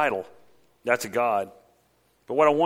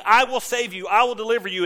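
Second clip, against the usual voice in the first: raised pleading God voice; God crying out; 8 semitones higher, 4 dB louder than usual. Retold or imitated. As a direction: call out to them as God. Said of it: "imagine God crying out to people".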